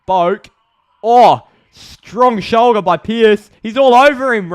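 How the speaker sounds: very loud, high-pitched protest; real fan outburst, slow and shouted; outraged match call. Real, not acted. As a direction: yell at the ref for a free kick. Protesting to umpire during play.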